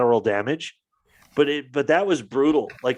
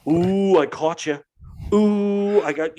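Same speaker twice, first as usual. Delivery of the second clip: mocking gloat; 6 semitones higher, slower than usual. Mimicking gloating political opponents.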